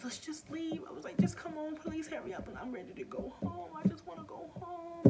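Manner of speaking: high, pleading and frightened; scared mother pleading with her son to leave; pleading, close to tears. Retold or imitated. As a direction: beg to go home, voice up high. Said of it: "I started crying to my son".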